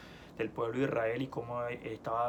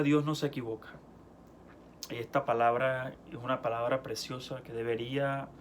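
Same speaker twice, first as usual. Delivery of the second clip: ordinary talk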